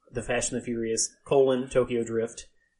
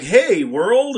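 podcast intro voice; 8 semitones higher, 10 dB louder than usual. Pretend. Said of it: "It's like".

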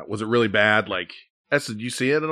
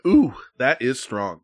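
ordinary talk

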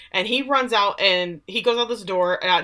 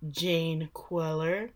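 slow, much softer voice; meek answer; 3 semitones lower, 9 dB quieter, much slower than usual. Retold or imitated. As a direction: say it softly, drawing it out. Meek answer to autograph question.

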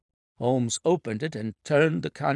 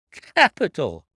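loud, less high second cry; emphatic repeat; 4 semitones higher, 7 dB louder, slower than usual. A narrator's reading. Inspector repeating his praise to Holmes, loud emphatic cry. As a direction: hammer the praise home once more. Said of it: "cried the inspector".

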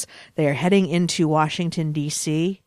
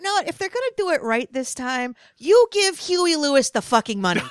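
mock rant; 7 semitones higher than usual. Ranting about paying [name].

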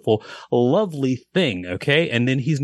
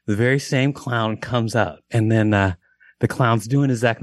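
ordinary delivery

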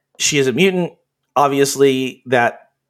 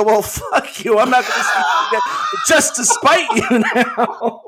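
laughing defiant shout; 8 semitones higher than usual. Retold or imitated. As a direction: yell it back while cracking up. Retold defiant reply, laughing shout.

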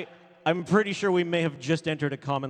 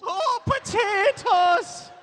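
mock Irish shout; much slower than usual. Echoing mock Irish line.

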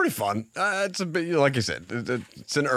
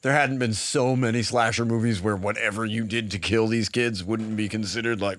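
ordinary delivery

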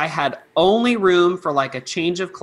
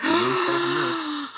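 high indignant quip; 13 semitones higher than usual. Retold or imitated. Retold quote, rescuing the puppet.

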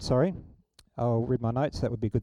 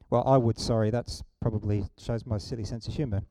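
ordinary delivery

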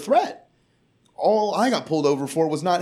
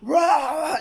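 mock furious shout; 12 semitones higher, slower than usual. Girlfriend screaming at the drunk woman, shouted rage. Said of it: "she starts flashing like".

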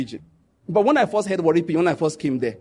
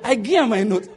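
chuckling through I give her my note; laughing repeat; 5 semitones higher than usual. Retold or imitated. Friend quoting classmate, laughing through the pidgin line. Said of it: "The guy said".

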